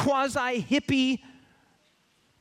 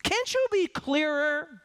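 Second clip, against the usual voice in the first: high, drawn-out plea to God; congregant's frustrated cry to God; exasperated prayer; 8 semitones higher, slower than usual. Acted. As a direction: complain to God, baffled. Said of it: "you're like".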